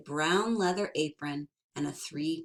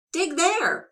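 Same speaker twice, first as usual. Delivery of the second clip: leprechaun voice; 12 semitones higher, 7 dB louder than usual. Acted. Leprechaun orders digging.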